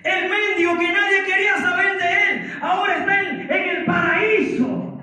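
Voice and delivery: high, fervent pulpit voice; preaching at full force. Real, not acted. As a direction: proclaim it to the congregation. Predica con fuerza ante la congregación. (prédica fervorosa a plena voz)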